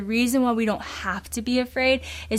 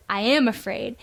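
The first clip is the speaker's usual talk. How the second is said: ordinary delivery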